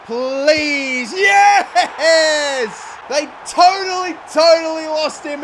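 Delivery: high, loud celebrating shout; real goal celebration. Real, not acted. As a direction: yell with joy as the goal goes in. Scoring a goal in the derby.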